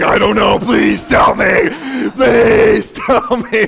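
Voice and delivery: loud, slow begging shout; desperate plea. Acted. Desperate parent begging pastor for answers, shouting. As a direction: cry out for help.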